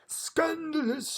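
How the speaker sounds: high, slow, loud outrage; shouted. Acted. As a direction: bellow it in royal outrage. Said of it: "shouted the king".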